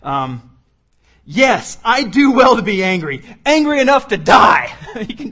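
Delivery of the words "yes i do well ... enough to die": high, loud, defiant outburst; petulant tantrum. Acted. Jonah answering God like a sulking child, defiant shouting. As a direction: throw a five-year-old's tantrum, climbing louder. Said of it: "have you ever heard, like, your five-year-old".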